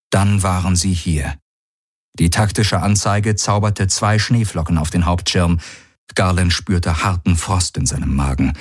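usual speaking voice

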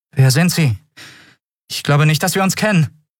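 high-pitched, guarded questioning; wary challenge. A narrator's reading. Prisoner confronts the unknown cat-man, wary challenge. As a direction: demand a name, keep your distance.

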